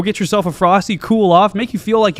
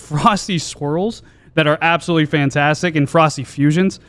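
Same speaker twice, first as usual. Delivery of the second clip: usual speaking voice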